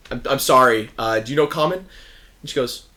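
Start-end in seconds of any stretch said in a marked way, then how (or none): 0.1-1.9 louder, flustered apology; a lightly voiced guard caught off guard. Guard apologizing to Bebo, a bit flustered. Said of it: "you watch the guy goes".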